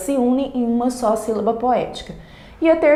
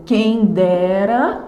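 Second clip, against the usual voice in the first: "quem dera" slowly, beat by beat, rising at the end; syllable counting; much slower than usual. Shown as a demonstration. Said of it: "De novo".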